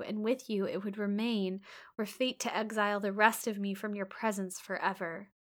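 usual speaking voice

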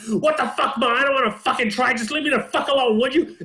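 high, fast mock bratty snarl; hammed-up furious kid; bratty teen. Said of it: "this is what I hear".

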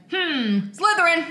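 high, loud pondering hat; sorting hat voice. Retold or imitated.

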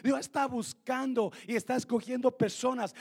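loud, high-pitched, driving sermon; full-force preaching. Real, not acted.

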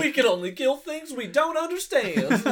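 high, loud laughing mock line. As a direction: laugh through a mock declaration. Joining the mockery.

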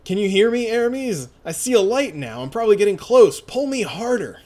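high, loud urgent calling. Acted. Heroine calls up to friend from hole, urgent calling. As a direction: shout up to your friend, pitched high.

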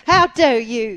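loud, high, slow hammed-up indignation; mock outrage. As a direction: play the scorned woman. Acted quarrel with cheater.

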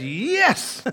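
high bright yes. Acted. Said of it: "The Holy Spirit says".

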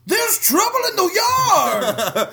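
high, slow alarmed shout with a laugh; panicked servant shout. Retold or imitated. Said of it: "He's like".

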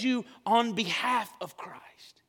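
slow, whispered explanation. Real, not acted. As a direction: draw them close with a whisper. Whispers while explaining begging.

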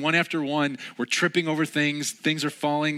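ordinary talk, nothing marked

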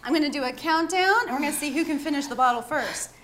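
ordinary delivery

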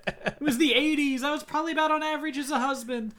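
high, loud spooky ghost wail. Pretend. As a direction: wail it like a hammy dead man. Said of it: "I don't know why you're doing the voice".

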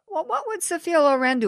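high-pitched, voiced-out question; quoted question, lifted high; asking someone aloud. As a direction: pose it aloud, pitched well up. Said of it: "you would always say to Regina".

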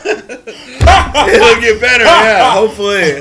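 loud laughing, high and slow. Real, not acted. Host laughing off a doomed show, loud high laugh. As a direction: laugh it out in disbelief.

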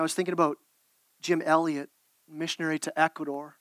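ordinary talk, nothing marked

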